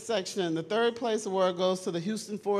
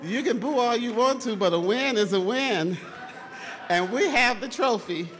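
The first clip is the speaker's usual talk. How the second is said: loud, gloating taunt at the crowd; sneering wrestling villain; 4 semitones higher, 5 dB louder than usual. Real, not acted. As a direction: rub the win in their faces. Crowd boos, she taunts back.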